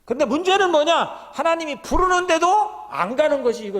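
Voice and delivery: high, loud rhetorical cry; preaching shout. Real, not acted. Preacher crying out the problem to the church. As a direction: hit the congregation with the question.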